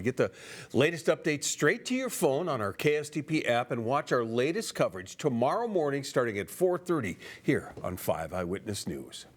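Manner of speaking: usual speaking voice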